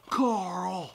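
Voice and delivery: drawn-out TV dad impression; Rick. Imitating Rick calling his son, drawn-out mock drama. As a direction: stretch the son's name in imitation. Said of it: "He says it like that all the time".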